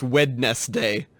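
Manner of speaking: "Wednesday" said WED-nes-day, every written syllable; as spelled. Shown as a demonstration. Co-host mocks spelling logic, wed-nes-day. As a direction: sound the first d in "Wednesday". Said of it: "that's like saying".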